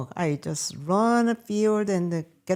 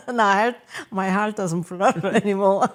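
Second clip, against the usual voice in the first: laughing; 6 dB louder than usual. Real, not acted. Laughing at own fading romance.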